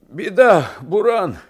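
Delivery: loud, high cry of warning; alarmed shout. A narrator's reading. Coachman warns his passenger of the blizzard, alarmed shout. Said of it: "закричал ямщик".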